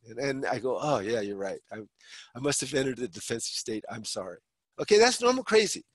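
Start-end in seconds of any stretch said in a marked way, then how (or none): 0.8-4.4 softer, lower, backing down; sheepish apology. Acted. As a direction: give in and apologize quietly. Acted sheepish apology after reassurance.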